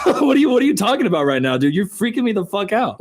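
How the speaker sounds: ordinary talk, nothing marked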